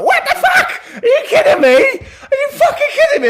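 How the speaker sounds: very high, loud outraged squeal; furious rat shriek. Acted. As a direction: shriek in disbelief at the thieves. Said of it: "all the rat folk go".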